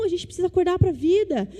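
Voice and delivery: ordinary talk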